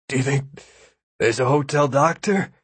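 halting, effortful question; weak, strained asking; barely managing. A narrator's reading. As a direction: get it out with difficulty, pausing midway. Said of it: "he managed to say".

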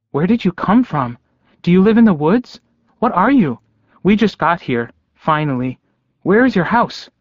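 put-on high-pitched, eager child voice; small boy's high, inquisitive voice; curious little boy. A narrator's reading. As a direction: pepper them with questions like an excited kid. Remembered little boy's rapid questions.

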